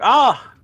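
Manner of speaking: loud, high gasp of alarm; acted startled cry; startled yelp. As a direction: jump with a sudden high "ah". Mike startled by the fall.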